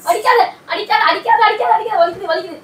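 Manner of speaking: high, loud pleading yelps; yelping protest. Real, not acted. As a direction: cry out while being hit. Husband being hit, crying out.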